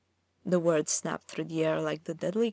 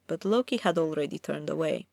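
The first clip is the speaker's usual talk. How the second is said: plain reading voice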